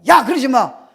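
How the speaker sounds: high, loud scolding; mother's scolding. Retold or imitated. Mother scolds her child, loud scolding shout.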